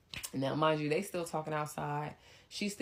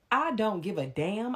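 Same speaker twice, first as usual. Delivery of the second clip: defiant; 6 semitones higher, 5 dB louder, slower than usual. Retold or imitated. Her retort to his complaint.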